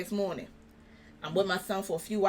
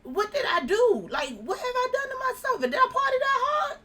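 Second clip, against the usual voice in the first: bewildered, pleading high voice; mock wail. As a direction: wail it in comic disbelief. Retelling her bewildered lament while sick.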